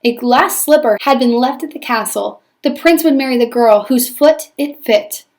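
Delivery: normal talking voice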